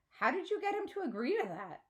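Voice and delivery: curious daughter's question; girl's question. A narrator's reading. Girl voiced in story, high.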